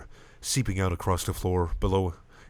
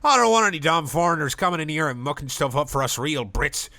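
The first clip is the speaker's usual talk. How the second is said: angry patron; 7 semitones higher, 4 dB louder than usual. Acted.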